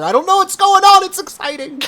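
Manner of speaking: high, loud thrilled burst; giddy excitement. Acted. Sig thrilled that everyone is revealing pets, high giddy burst. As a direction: gush like everything is a wonderful surprise.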